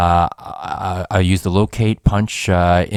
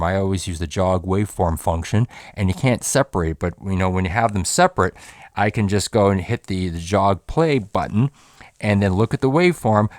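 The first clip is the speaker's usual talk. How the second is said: ordinary talk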